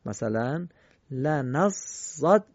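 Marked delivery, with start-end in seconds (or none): 1.1-2.4 slow, held لنصدقن, each doubled letter lingered on; slow recitation with three shaddas. Shown as a demonstration. Teacher showing a word with three shaddas, slow and careful. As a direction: spell out every shadda in لنصدقن. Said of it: "سه تا تشدید داره".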